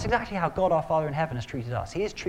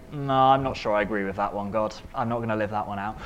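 stubborn believer talking back; 6 semitones lower, faster than usual. Retold or imitated. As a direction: lower voice, brushing off God's command. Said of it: "we say".

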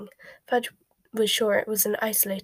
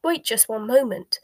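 loud, high opening protest; raised-voice objection, highest on the first word; shout; 4 semitones higher, 5 dB louder than usual. A narrator's reading. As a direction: shout to stop someone leaving. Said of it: "could shout".

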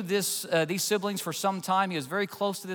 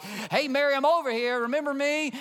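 mock call to Mary; 7 semitones higher than usual. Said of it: "something like".

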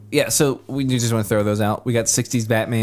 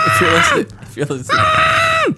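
dying-sounding wail; 6 dB louder than usual. Shown as a demonstration. Showing the worst movie ever to listeners, shrieking wail. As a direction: overblown screech like someone dying. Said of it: "sounds like you're dying".

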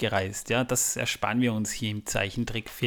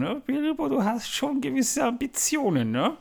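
high teasing voice, much higher; mock teasing voice; 10 semitones higher than usual. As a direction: tease Bilbo in a high squeaky tone. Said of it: "da denke ich mir". (hohe, neckende Stimme)

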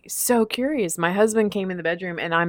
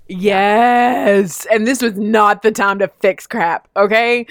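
exasperated; 3 semitones higher, 8 dB louder, slower than usual. Real, not acted. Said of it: "venting about husband".

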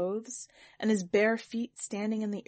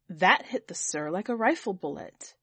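ordinary delivery, nothing marked

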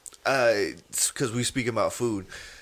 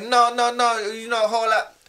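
high, raised, insistent female voice; imitated manager cutting in; 8 semitones higher than usual. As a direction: mimic her interrupting to challenge the customer. Voicing manager cutting customer off.

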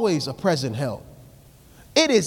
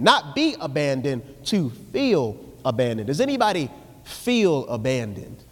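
ordinary delivery

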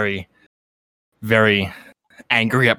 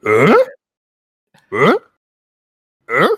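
Tim Allen grunt; much slower than usual. Acted. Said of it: "That's how Tim Allen communicates".

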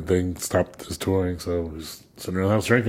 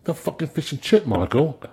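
quoting Pete; 6 semitones higher than usual. Retold or imitated. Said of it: "He goes".

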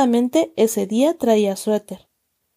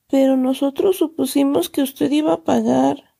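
close to tears; 4 semitones higher than usual. A narrator's reading. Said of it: "dijo Agustín al borde del llanto".

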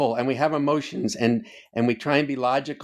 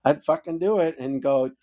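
usual speaking voice

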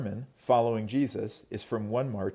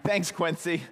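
usual speaking voice

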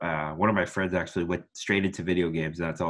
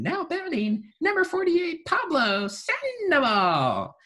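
very high, slow, ringing ballpark PA voice; Renel Brooks-Moon impression; 4 dB louder, slower than usual. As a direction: stretch the name out to fill the stadium. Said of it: "my voice can go up and higher".

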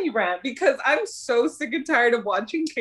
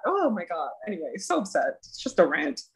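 normal talking voice

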